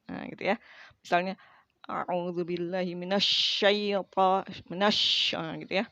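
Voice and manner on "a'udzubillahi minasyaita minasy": slow recitation with the sy in minasy blown wide; spreading shin. Shown as a demonstration. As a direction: let air spread over the shin. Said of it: "yaitu shin".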